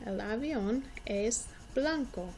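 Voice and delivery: ordinary delivery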